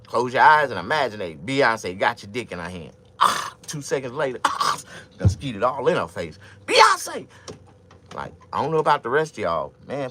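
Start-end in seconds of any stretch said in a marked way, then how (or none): 0.1-6.4 loud, excited fantasy pitch building to a yell; hyped fantasy. Acted. Acting out fantasy loudly. 6.7-7.3 high, loud squealing shout; yelled name. Acted. Climax shout.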